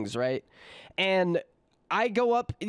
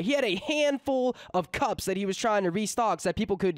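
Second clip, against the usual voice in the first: normal talking voice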